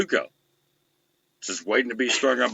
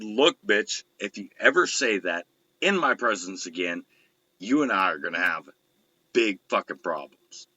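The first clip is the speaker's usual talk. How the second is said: low menacing threat; 7 semitones lower than usual. Retold or imitated. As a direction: drop the voice and threaten. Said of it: "these are my exact words".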